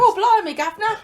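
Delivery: high, loud hammed-up Cockney accent. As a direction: go over the top with a Cockney accent. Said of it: "that was [name]'s Cockney accent".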